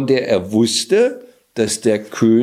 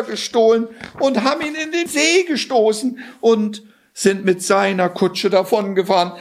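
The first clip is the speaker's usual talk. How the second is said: much higher, feigned panicked relief; frantic cry; 13 semitones higher than usual. Acted. Cat stops the king's coach, much higher feigned panic. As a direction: play the desperate rescue plea. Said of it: "rief der Kater".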